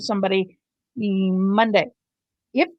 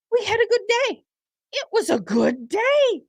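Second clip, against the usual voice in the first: imitating the woman; 11 semitones higher than usual. Host mocks the witness's good-day quote for viewers. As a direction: mimic the cheerful witness. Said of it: "sound bite of this cray-cray woman".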